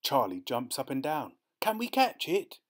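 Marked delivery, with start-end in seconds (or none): 1.6-2.6 put-on very high, eager child voice; excited boy. A narrator's reading. Boy jumping up and down by the ditch, excited. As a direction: ask it high, bursting with hope.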